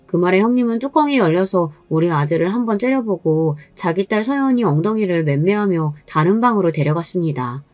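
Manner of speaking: usual speaking voice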